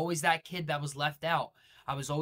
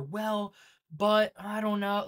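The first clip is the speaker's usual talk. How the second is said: higher, drawn-out whiny excuse; mock hesitant excuse; 6 semitones higher, 4 dB louder, slower than usual. Coach mocking a viewer's lame excuse, whiny. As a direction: drag the excuse out like a mock wimp. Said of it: "cut them fucking words out".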